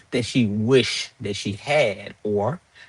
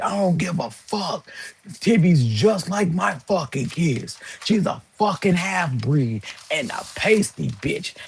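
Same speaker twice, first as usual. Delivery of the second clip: a hint of a sneering mock voice, higher; mocking her; 6 semitones higher than usual. Quoting the ex-friend's insult in a sneering mock voice. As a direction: imitate her with a slow, dismissive sneer. Voicing her rude reply.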